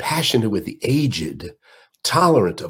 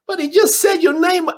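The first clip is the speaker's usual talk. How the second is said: very high, loud protest; entourage protest; 4 dB louder than usual. Retold or imitated. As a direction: whine it high.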